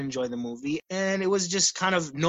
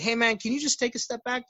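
higher-pitched, polite retold plea; polite request; 7 semitones higher than usual. Cashier to a customer crowding his space, politely. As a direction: ask nicely, pitched up.

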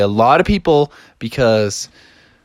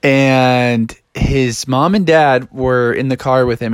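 ordinary delivery, nothing marked

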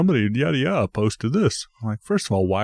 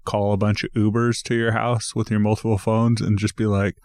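normal talking voice

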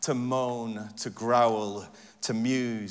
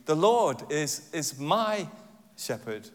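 my drawn out and raised, then a pause; stress on my; slower than usual. Shown as a demonstration. Preacher reassuring himself about next steps, stress on my. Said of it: "you emphasize different words of that passage".

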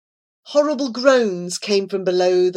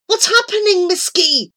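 higher, louder desperate question; desperate cry; 12 semitones higher, 5 dB louder than usual. A narrator's reading. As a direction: cry out, blind and frightened. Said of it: "he cried desperately".

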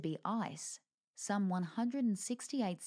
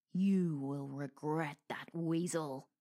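menacing wolf; 3 semitones lower, slower than usual. Acted. Wolf threatening the ferret.

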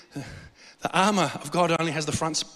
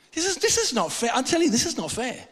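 high, plaintive "not fair" protest; mock complaining protest; 7 semitones higher than usual. Preacher protesting his lot at a conference, mock complaint. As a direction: whine it like someone wronged, pitched well up.

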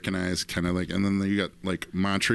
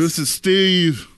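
slow, grand and louder; announcer voice; 7 semitones higher, 6 dB louder, much slower than usual. Pretend. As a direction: introduce him like an emcee. Presenting Steve.

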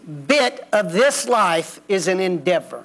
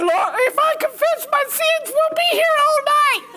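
much higher, fast, whiny begging; drunk kid begging. Retold or imitated. The drunk kid begs the preacher to pray, high whiny pleading. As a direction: plead over and over like a blubbering drunk. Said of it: "He said".

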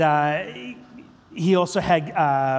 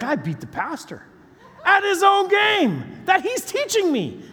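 imitating a triumphant crow, building to a high shout; gleeful boast; 13 semitones higher than usual. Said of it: "his face just lit up".

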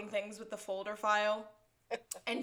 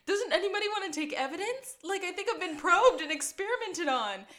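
high-pitched mock outrage, breaking into laughter; imitating indignant complainer; 8 semitones higher, 4 dB louder than usual. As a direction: play the aggrieved victim, voice pitched up. Voicing the indignant naked man.

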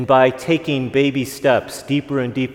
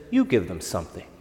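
low, soft, quietly confident, no bravado; steady Jesus telling disciples, low and calm; calm and firm; 7 semitones lower, 6 dB quieter, faster than usual. Acted. Jesus looks disciples in eye, calm firm. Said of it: "'Cause he doesn't say it with bravado".

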